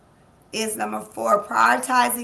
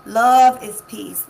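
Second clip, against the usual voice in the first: emphatic affirmation; 11 dB louder, slower than usual. Real, not acted. Love affirmation litany.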